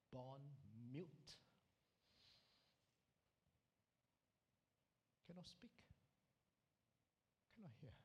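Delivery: very soft, with long heavy pauses; hushed revelation. Acted. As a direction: let each fact land quietly. Mother reveals daughter was born mute.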